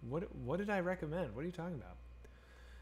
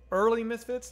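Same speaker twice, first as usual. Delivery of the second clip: higher and louder burst of enthusiasm; excited emphasis; 8 semitones higher, 9 dB louder than usual. Real, not acted. Streamer answering chat about Misfits, excited burst. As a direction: lift it up with fan excitement.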